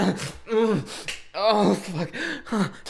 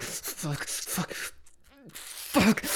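breathy unvoiced gasps, last one higher; breathless gasps; 6 semitones higher, 7 dB quieter, much slower than usual. Lover at climax with partner, gasping. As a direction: gasp the words out of breath.

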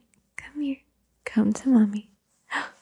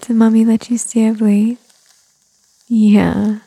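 ordinary delivery

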